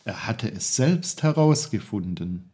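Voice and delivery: ordinary delivery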